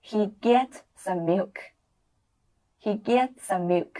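slow, clear model sentence for students; slow repeat-after-me. Shown as a demonstration. Teacher models for students, slow and clear. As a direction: spell it out slowly for the class. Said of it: "句子跟我再来读一遍".

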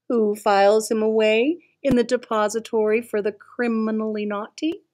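plain reading voice

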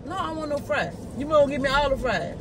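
ordinary talk, nothing marked

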